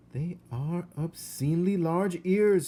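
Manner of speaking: high, loud appalled aunt; Aunt Florence appalled. Acted. Aunt judging the ears, high and appalled. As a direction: pronounce it as a scandal. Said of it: "said Aunt Florence".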